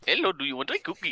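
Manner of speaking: lightly voiced Indian accent; a hint of an Indian accent offering cookies; Indian accent Cookie Monster. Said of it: "he's like".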